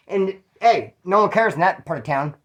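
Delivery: normal talking voice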